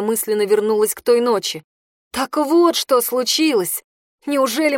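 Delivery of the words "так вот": plain reading voice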